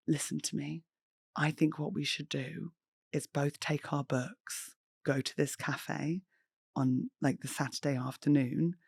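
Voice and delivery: quiet, tender persuasion; soft coaxing. Retold or imitated. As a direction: lean in and coax softly. Gently persuading friend, retold.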